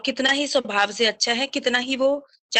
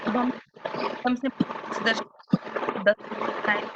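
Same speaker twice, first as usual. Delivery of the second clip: voice cutting out; 4 dB quieter, slower than usual. Real, not acted. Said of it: "हाँ जी, आवाज cut रही है।".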